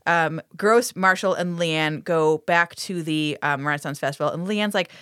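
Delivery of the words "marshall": normal talking voice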